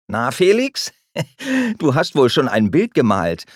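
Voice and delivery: teasing question broken by a laugh. A narrator's reading. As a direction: tease him while chuckling. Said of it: "fragt Luis lachend".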